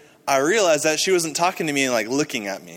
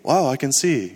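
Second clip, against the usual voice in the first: mild, contained wow; understated freaking out. Retold or imitated. Imitating the local version of freaking out, understated amazement. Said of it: "their version of freaking out is like".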